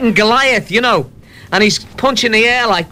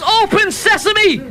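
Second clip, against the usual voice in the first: high, drawn-out call; shouted command; 14 semitones higher, slower than usual. Acted. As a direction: call it out grandly, high and slow. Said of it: "he shouts".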